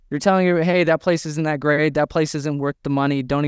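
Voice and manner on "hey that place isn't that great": ordinary talk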